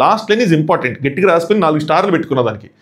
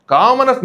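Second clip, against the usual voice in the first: Commoners blurted out high; emphatic; 7 semitones higher, slower than usual. Real, not acted. Reviewer stresses commoners for his viewers, emphatic. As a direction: hit commoners hard and high, like a verdict. Emphatic outburst on key word.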